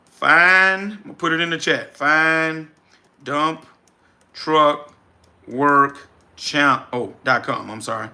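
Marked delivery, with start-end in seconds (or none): 2.0-7.7 each word set apart by long pauses; slow dictation. Real, not acted. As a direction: spell it out word by word for the chat. Dictating the website to chat.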